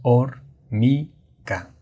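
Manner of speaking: "Hormiga" very slowly as hor, mi, ga; split into syllables. Shown as a demonstration. Teacher dividing a word for students, "Hormiga" in three separate syllables. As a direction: say "Hormiga" syllable by syllable, pausing between each. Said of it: "se divide en, en tres sílabas".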